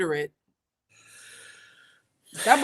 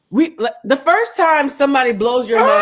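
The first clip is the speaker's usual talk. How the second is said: laughing rant; 5 semitones higher, 8 dB louder than usual. Real, not acted. Laughing fit while ranting.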